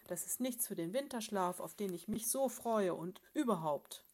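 a cross bear listing all his complaints; the bear husband, grumbling to his wife at length; grumpy bear father. Acted.

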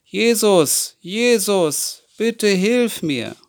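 laut und höher rufen, langsam flehend; laut rufend. A narrator's reading. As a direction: ruf verzweifelt nach Jesus, laut und hoch. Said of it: "ruft Bartimäus ganz laut". (Bartimäus ruft laut nach Jesus, höher und flehend)

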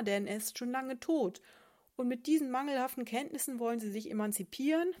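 pompous sponge. Acted. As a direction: lecture her like a self-important professor. Pompous sponge lecturing the sardine.

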